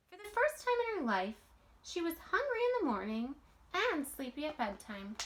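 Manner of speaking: put-on lively read-aloud voice for children; storybook reading. A narrator's reading. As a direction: read it like a bedtime picture book. Reading picture book aloud.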